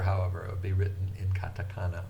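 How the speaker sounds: ordinary delivery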